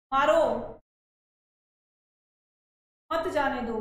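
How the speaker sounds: मारो alone, long pause, then मत जाने दो run together; pause after मारो. Shown as a demonstration. Teacher shows the comma that gets him beaten, pausing after मारो. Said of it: "लेकिन अगर हमने ये कह दिया".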